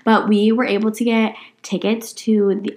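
ordinary talk

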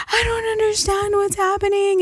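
high, distressed plea; crying kid who doesn't understand; upset crying girl. Retold or imitated. Panicking quote, crying girl.

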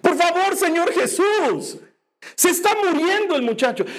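desperate father begging Jesus. Acted. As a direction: beg urgently for your son's life.

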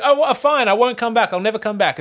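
lifted high, a hurt whine that fades; sulky high whine. Retold or imitated. Stalker whining high as he's sent off. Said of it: "he's like".

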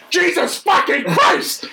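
high, drawn-out exasperated shout; mock-outraged yell, each word stretched; outraged crowd. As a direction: cry out like a whole line losing it.

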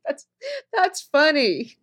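high, drawn-out words through laughter; amused laughter. Retold or imitated. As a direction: laugh it off, loud and slow. Laughing reaction to the psychic's claim.

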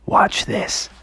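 soft, whispered heads-up; stealthy whisper; hushed cue. Acted. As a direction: whisper it before making a move. Secretive cue before firing laser.

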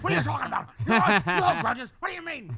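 loud, high ranting Alex Jones voice; Alex Jones impression. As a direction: rant like a conspiracy radio host. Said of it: "Your own Alex Jones inside your brain going".

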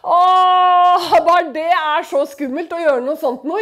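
half-hammed anxious cry; nervous believer voiced as an anxious wail; mock fearful wail. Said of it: "og du er bare liksom".

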